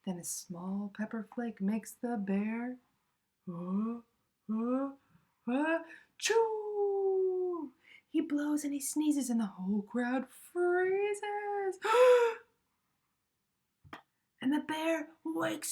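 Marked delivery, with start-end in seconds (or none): 3.5-7.7 uh uh uh building to a loud high chew; building sneeze. A narrator's reading. Pepper flake makes the sleeping bear sneeze loudly. 11.8-12.4 sharp high gasp; loud startled gasp. A narrator's reading. The crowd gasping as the bear sneezes.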